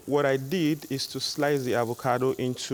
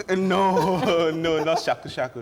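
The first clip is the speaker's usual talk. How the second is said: laughing denial; 4 semitones higher, 6 dB louder, slower than usual. Real, not acted. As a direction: laugh while correcting them. Chef laughs off a wrong guess.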